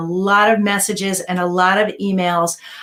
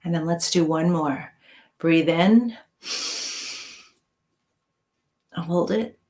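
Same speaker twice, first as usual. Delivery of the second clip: guided meditation; 4 dB quieter than usual. Acted. Guides breathing and relaxation.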